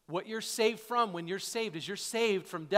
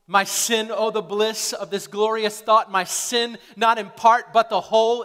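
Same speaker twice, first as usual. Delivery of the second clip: hymn lyric; 2 semitones higher, 14 dB louder than usual. Acted. Quoting a hymn with fervour.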